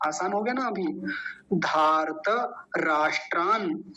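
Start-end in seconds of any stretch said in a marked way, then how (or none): 1.5-3.8 slow धार्त राष्ट्रान, then a short stop; pause after word. Shown as a demonstration. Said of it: "यहाँ तक रुकेंगे हल्का सा". (यहाँ हल्का सा रुकना)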